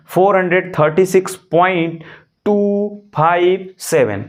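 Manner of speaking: slow, with टू five seven spaced out one by one; slow demo reading. Shown as a demonstration. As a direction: spell the digits out slowly like a teacher. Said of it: "English में इसको पढ़ना होगा तो".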